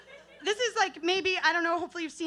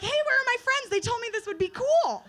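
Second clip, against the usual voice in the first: put-on higher, louder eager little girl; an excited kid looking for her friends; 8 semitones higher, 4 dB louder than usual. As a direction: call out hopefully like a third grader. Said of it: "And I'm like".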